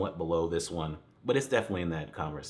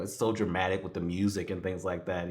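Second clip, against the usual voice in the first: ordinary delivery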